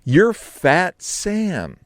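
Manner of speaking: slow, whimpering echo; mock wounded. Sam echoing the insult in a mock whimper. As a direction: whine it like a hurt child.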